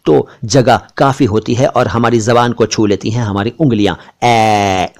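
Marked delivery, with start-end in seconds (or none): 4.2-4.9 "ए" held long as open "ae"; wide-open AI. Shown as a demonstration. Teacher modelling for viewers, long open "ए". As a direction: drop the jaw wide and sustain "ए" as "aeee". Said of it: "ए बोलते वक्त हम अपने मुंह को ज्यादा".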